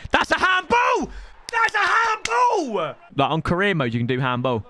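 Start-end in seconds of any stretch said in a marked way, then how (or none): 0.1-1.1 indignant shout; handball shout. Real, not acted. Appeal for handball in game. 1.5-2.9 higher, slow stretched yell; mock-outraged wail; drawn-out handball cry. Real, not acted. Repeats appeal, drawn out.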